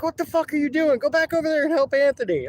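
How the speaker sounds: high, strained, exasperated shout; exasperated yell. Retold or imitated. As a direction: yell it at the friend who came over for no reason. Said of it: "He's like".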